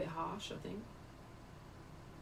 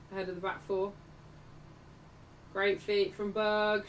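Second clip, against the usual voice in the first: ordinary talk, nothing marked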